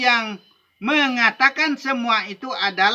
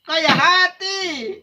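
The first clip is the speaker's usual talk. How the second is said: "Kaya hati" drawn out slowly, much higher and louder; emphatic punchline; 9 semitones higher, 6 dB louder, much slower than usual. Real, not acted. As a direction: stretch "Kaya hati" as the big reveal. Punchline answer to rhetorical question. ("Kaya hati" diucapkan pelan, tinggi dan keras sebagai jawaban)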